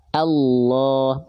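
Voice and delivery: "Allah" with a heavy, dark lam, almost "Alloh"; thick lam in Allah. Shown as a demonstration. Teacher showing students the heavy lam in Allah. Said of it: "dibaca tebal".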